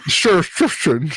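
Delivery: slow Connery voice, slushy s; lightly done Scottish film star; Sean Connery. Retold or imitated. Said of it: "Sean Connery".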